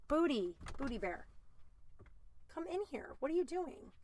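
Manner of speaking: calling a pet bear into the room, softly; talking to the pet. Real, not acted. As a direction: coax the pet in. Talking to her pet mid-story.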